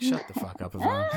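exasperated shout at Ivan; annoyed group shout. Acted. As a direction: yell him down, fed up. Everyone scolds Ivan.

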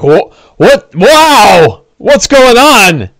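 high, loud bewildered cry, drawn out at the end; Don John. Acted. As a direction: exclaim in slow, baffled alarm. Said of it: "And he seems confused".

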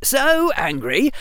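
put-on a boy forcing himself to rage; hammed-up angry boy; mock anger. Harry acts angry.

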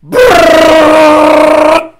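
loud buzzing brrr of a rattle gun; hammed-up impact wrench sound; loud impact wrench noise. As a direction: blast out a long power tool brrr. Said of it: "Justin Gully will go".

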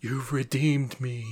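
slow, soft ghost voice dissolving into the air; Byron's ghost. Acted. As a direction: fade away like a grateful spirit. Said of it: "As he dissipates into the air".

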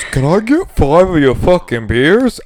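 a light send-up of a Canadian tourist; mock Canadian customer. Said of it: "I got a Canadian who's".

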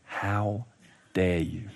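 very low, quiet parental rebuke; controlled indignation. Acted. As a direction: hold the anger in, spacing out the words softly. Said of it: "count to ten in my head so that it comes out right".

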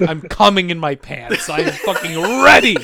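ordinary talk, nothing marked

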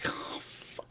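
barely voiced retold despair; dismayed whisper. Filmmaker realizing his partner has quit, whispered dismay. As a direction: let it out as a faint whisper.

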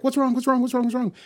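high, loud, frantic repeated question; mock frantic worry; panicked. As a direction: keep asking in rising alarm. Soji panics over collapsing Picard.

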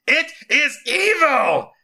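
slow, high, full-volume shout; yelled back. A narrator's reading. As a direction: thunder it back, drawing out each word. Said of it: "yelled back Solomon".

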